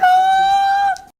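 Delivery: sudden high yelp; startled shout. Real, not acted. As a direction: yelp out in surprise.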